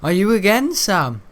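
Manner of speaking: high sneering cousin voice; mocking cousin. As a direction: mimic his mocking tone. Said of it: "he used to be".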